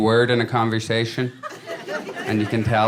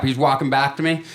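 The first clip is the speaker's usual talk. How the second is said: ordinary talk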